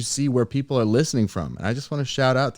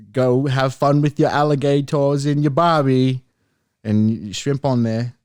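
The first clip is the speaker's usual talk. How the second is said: bad Australian accent; 5 dB louder than usual. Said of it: "That's terrible. I'm sorry".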